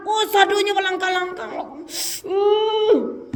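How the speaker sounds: hammed-up angry grunt; mock anger. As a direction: play the hothead losing control. Acting out someone getting angry.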